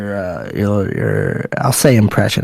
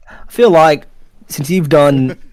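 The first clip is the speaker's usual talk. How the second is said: higher-pitched Australian attempt; Australian accent; 4 semitones higher than usual. Shown as a demonstration. Friend having a go after the American accents, Australian accent. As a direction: try out Australian, pitched up in an odd range. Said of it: "for my Australian. I kinda, kinda stay in this weird range".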